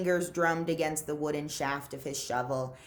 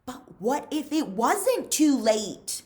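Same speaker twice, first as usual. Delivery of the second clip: slow, higher, louder question; rising hope; 7 semitones higher, 6 dB louder than usual. A narrator's reading. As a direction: lift it with sudden hope.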